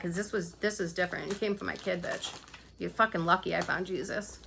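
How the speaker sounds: restrained, dropping to near hush. Real, not acted. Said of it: "I'm holding a lot back".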